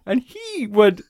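usual speaking voice

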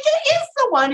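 high, eager insistence; insistent excitement. Retold or imitated. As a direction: convince her it's this dog. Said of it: "He's like".

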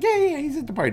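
high, breezy brush-off; dismissive mayor. Retold or imitated. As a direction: toss it off way up high like it's nothing.

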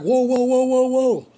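loud, high string of alarm; retold alarmed shout. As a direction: reenact shouting someone to a stop. Driver stops passenger opening door.

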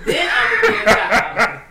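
laughing shout. Real, not acted. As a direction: laugh it out slowly. Playful threat laughing.

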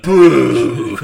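loud mock crowd boo. Retelling the audience booing the cut sketch, loud. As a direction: boo like a whole theatre audience. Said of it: "everyone was like".

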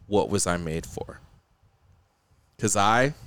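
low, measured recital with long pauses; spoken song lyrics. Pretend. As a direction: recite a sad pop song like a poem. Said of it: "speak the lyrics to you".

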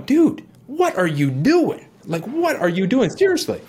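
high, stretched-out disbelief, shooting up at the end; an inner voice that can't believe what it's seeing; incredulous inner voice. Retold or imitated. His own mind stopping him mid-relapse, high incredulous disbelief. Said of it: "the mind starts going".

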